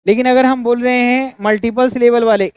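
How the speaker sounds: ordinary talk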